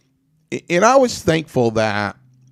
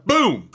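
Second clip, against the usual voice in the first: sudden mouth gunshot noise; mock gunshot; 10 semitones higher than usual. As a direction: blurt the gunshot out with a bang. Second retold shooting.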